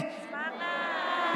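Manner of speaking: ordinary talk, nothing marked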